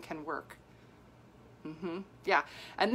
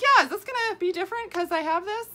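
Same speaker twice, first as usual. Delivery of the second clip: much higher, casual and clueless; innocent high voice; 11 semitones higher, 5 dB louder than usual. Retold or imitated. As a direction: shrug it off in a high little voice. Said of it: "I was like".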